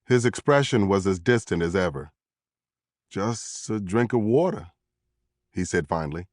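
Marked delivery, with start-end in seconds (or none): 3.1-4.7 slow, dazed mumbling answer; drugged suspect. A narrator's reading. Drugged suspect finally answering the investigator, slow and dazed. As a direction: drag it out, far away and groggy.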